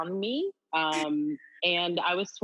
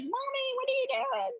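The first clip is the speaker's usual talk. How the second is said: put-on high little kid asking from the back seat; imitating a young child calling to mom from the car; child's voice. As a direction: play her small daughter, high and curious. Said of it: "my kids are in the car".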